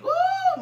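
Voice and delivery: a falsetto ooh through laughter; laughing high ooh. Real, not acted. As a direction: lift a laughing cry up high. Preacher overcome mid-sermon.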